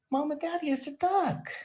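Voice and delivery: put-on very high childish voice, insisting; whiny kid voice. Jamie at thirty insisting to parents it's a duck, high childish whine. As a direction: squeal it like a stubborn little boy.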